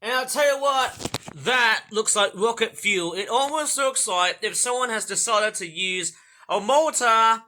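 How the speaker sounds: big, loud show-host delivery; loud presenter voice. Acted. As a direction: sell it like a TV host.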